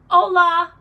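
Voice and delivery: Hola! called out high and loud; cheery Spanish greeting, Hola! belted high; excited hola. Real, not acted. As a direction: throw out a bright, loud Hola!.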